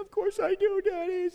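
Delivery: hint of a ten-year-old forgiving; lightly voiced little girl. Daughter forgiving her father, lightly voiced child. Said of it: "She said".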